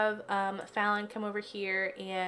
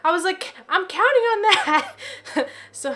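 high loud excited exclaim; 9 semitones higher, 10 dB louder than usual. Real, not acted. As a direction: burst out with delight. Relief at finding flowers.

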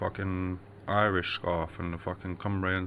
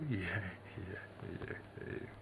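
ordinary delivery

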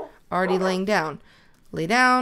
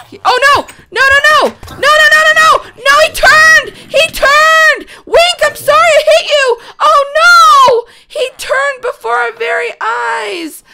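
shrieking high and loud in horror; frantic screams as the villager turns; panicked screaming; 14 dB louder than usual. Real, not acted. Gamer to her dog Wink, screaming in panic.

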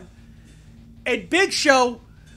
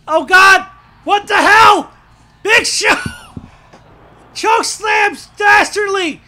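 high, loud, slow disbelieving yells; real angry bewilderment; outraged shout; 6 semitones higher, 5 dB louder than usual. Real, not acted. Intruder choke slams his wrestler.

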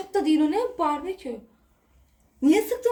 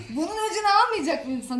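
usual speaking voice

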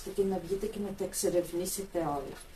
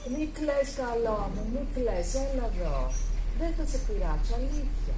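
put-on soothing baby talk; 4 semitones higher, slower than usual. Comforting a crying little animal, high sweet baby talk. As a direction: hush the little one gently.

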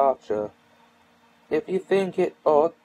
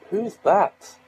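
irritable; 7 semitones higher, 3 dB louder than usual. Acted. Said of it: "said Eustace irritably".

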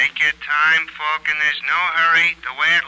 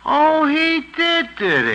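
drawn-out, pitched-up mock surprise; sarcastic surprise; 11 semitones higher than usual. Sergeant catching Falcon out, slow sarcastic surprise. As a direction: pounce on the news with slow irony. Sergeant hears of a shot at Mike.

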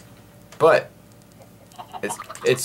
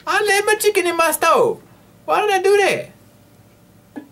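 high squeaky voice, much higher; silly falsetto. Pretend. Gamer fretting over chicken left in stove, squeaky high.

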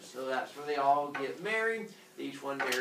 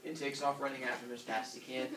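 ordinary delivery

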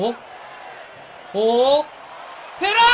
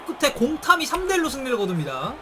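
announcer wrap-up; 6 semitones higher than usual. Acted. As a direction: announce the result like a broadcaster. Announces match result. (중계 캐스터 마무리 멘트)

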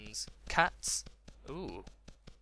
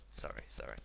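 low, soft murmur; hushed apology; 12 semitones lower, 9 dB quieter than usual. Real, not acted. Gamer apologising after scolding himself, hushed. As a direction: apologise under your breath.

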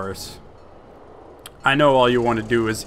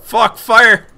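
sudden alarmed yell, much higher and louder; panicked shout; 11 semitones higher, 12 dB louder than usual. Real, not acted. Gamer yelling as fire erupts, panicked shout. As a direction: yelp it as fire hits you.

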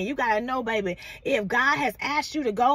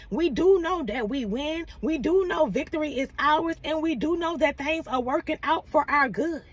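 ordinary delivery

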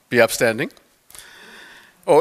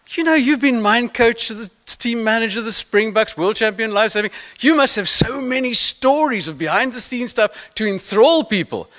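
higher, bright urging voice; lightly voiced keen fan urging more stories; eager audience member; 7 semitones higher than usual.

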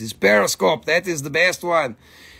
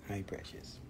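tender whisper; 9 semitones lower, 21 dB quieter than usual. Real, not acted. Greeting the dog tenderly.

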